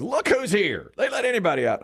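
lightly voiced teasing relative; teasing woman. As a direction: rib him like an old friend. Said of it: "she goes".

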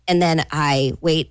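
ordinary talk, nothing marked

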